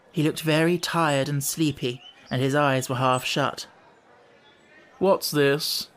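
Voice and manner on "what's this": lightly voiced hollow lion; deep hollow tone. A narrator's reading. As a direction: toll the words like a great bell. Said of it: "deep, hollow tone".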